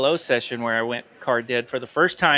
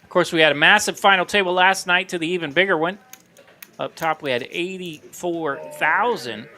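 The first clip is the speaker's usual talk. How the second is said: upbeat, higher announcer promo; promo read; 4 semitones higher than usual. Acted. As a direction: sell the tournament like an ad. Casino tournament promo read.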